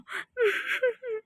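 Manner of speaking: soft, high trailing giggle; a stifled giggle. Real, not acted. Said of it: "बहुत ही हंसी आ रही है तुम्हें?". (दबी हुई खिलखिलाहट)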